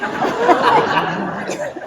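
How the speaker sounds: loud, noisy mock roar of frustration; hammed-up explosion at the neighbor; mock-angry outburst. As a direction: burst out with a big exasperated noise. Acts out being pissed off at neighbor.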